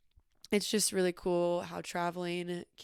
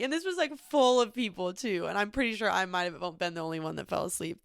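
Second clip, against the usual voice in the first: ordinary talk, nothing marked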